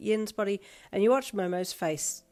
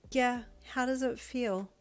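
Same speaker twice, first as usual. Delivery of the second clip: higher, slow, teasing smirk; 6 semitones higher, slower than usual. Acted. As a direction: tease with an audible smirk. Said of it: "asked you with a smirk".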